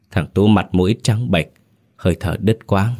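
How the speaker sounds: plain reading voice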